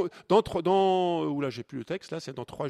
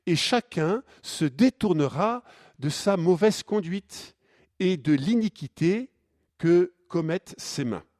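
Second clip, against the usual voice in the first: loud, measured reading of the Bible text; scripture reading; 3 semitones higher, 10 dB louder than usual. Acted. Priest reading Jonah to the congregation, solemn and loud. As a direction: proclaim the passage slowly to the congregation.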